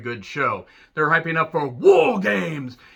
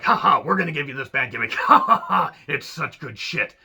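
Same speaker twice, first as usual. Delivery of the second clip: mock boss. Mocking boss handing gimmick.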